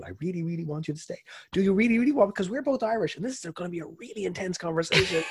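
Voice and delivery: pitched far up, hammed-up pleading; high mock insistence. Irish host begging the guest to stay, high and hammed-up. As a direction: overplay the eager host.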